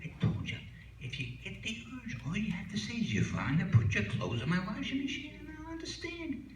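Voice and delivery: high, scolding wife voice; exasperated wife. Acted. Wife scolding Sam in bed, high-pitched exasperation. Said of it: "She says".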